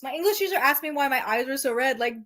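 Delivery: usual speaking voice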